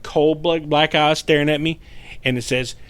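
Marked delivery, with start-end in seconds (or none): none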